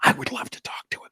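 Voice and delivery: fast excited whisper; whispered eagerness. Retold or imitated. Narrator agreeing to talk to Mark, whispered fast. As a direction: breathe it out eagerly.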